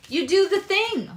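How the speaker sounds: emphatic shout. Real, not acted. Emphatic urging of viewers.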